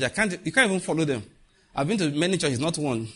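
normal talking voice

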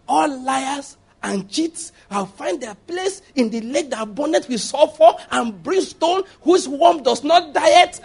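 high, slow, booming doom warning; fiery hellfire preacher. Shown as a demonstration. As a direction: thunder it out like a prophet of judgment. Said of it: "when you do it like this".

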